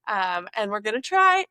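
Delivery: usual speaking voice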